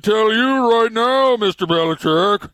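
high, loud, slow pompous tycoon; Bob Kraft snooty rich guy voice. Acted. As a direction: puff up like a snooty rich owner. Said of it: "that's my snooty rich guy voice".

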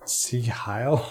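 sigil as see-HILE, with an H for the G; questioning see-hile, rising at the end; joke mispronunciation. Shown as a demonstration. Host offering another silly reading of sigil, see-hile. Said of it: "Okay, that's not gonna work".

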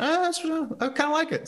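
high-pitched, reluctant coming round; retold grudging approval in a high voice; grudging admission. Retold grudging change of mind.

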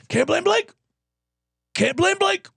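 high, far-off catchphrase voice; mock catchphrase. Mimicking a catchphrase, much higher.

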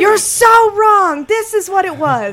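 high, slow hammed-up rant; scolding the hosts as an angry listener; mock outraged fan.

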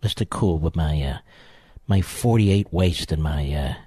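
a lightly put-on low voice; mocking impersonation. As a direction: drop low and drag it out as a self-satisfied Mister Cool. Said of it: "Listen to my voice. It's even changing".

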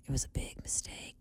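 hushed whispered regret. Real, not acted. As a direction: whisper it to yourself. Repeated quietly as aside.

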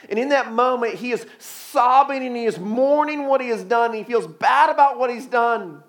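high, driving pulpit delivery; impassioned preaching. Acted. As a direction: preach it hard, pitched high, leaning on the key words. Preacher in full voice.

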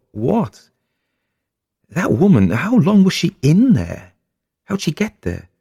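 high, loud, wondering questions; spooked curiosity; wife wondering about the hidden woman, high and eager. Acted.

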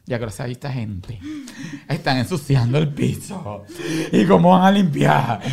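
imitando una queja agobiada, arrastrando las palabras; estresada. Retold or imitated. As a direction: quejarse lentamente de los invitados. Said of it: "Y entonces me estresa". (agobiada y quejumbrosa)

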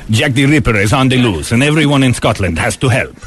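put-on Scotland Yard chief in Brynner's foreign accent; Yul Brynner accent. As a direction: play the police chief in mock Brynner accent. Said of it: "director of the Scotland Yard".

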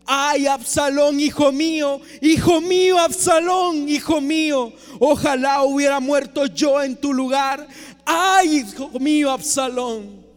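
a mild acted wail of a grieving king; grieving lament. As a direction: cry out for the lost son, slowed. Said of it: "Lloraba y decía".

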